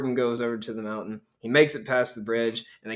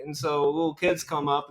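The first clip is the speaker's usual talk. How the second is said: ordinary delivery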